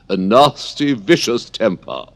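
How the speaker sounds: high, loud, haughty queen voice; Red Queen. Acted. Red Queen judging another's temper, high loud and haughty. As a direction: sneer it out as the imperious Red Queen, slowing on the end. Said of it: "the Red Queen remarked".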